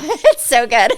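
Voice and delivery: laughing through the words. Real, not acted. Telling friend about taco place, laughing hard.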